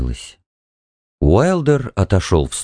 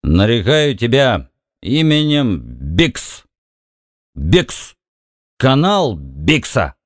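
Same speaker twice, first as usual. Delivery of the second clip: put-on slurred drunk speech; slower than usual. A narrator's reading. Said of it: "заплетающимся языком".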